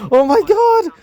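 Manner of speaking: very high squealed outburst; high-pitched pretend screaming; mock fangirl scream. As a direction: squeal it like a crowd of smitten girls. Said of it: "all of the girls would also instantly start screaming".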